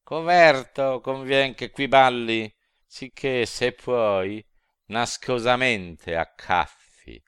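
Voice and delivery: loud, high sneering devil voice; mock him as you hook him; demons mocking. A narrator's reading. Devils mock the sinner as they hook him, loud sneering cry.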